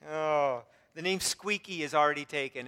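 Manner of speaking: ordinary talk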